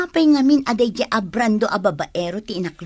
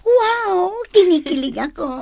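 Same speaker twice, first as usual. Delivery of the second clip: much higher giddy wow; giddy gush; 10 semitones higher than usual. Acted. Cousin swooning over the love story, much higher. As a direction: swoon with delight.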